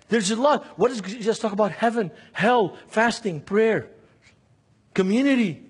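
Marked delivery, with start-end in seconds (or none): none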